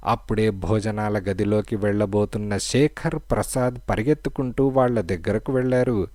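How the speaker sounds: plain reading voice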